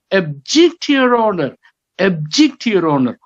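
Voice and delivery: "object" said as ub-JECT, weak first vowel; stress on second syllable. Shown as a demonstration. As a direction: lift and punch JECT in "object". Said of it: "he doesn't say".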